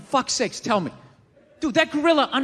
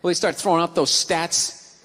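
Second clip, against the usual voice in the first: ordinary talk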